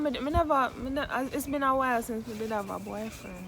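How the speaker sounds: normal talking voice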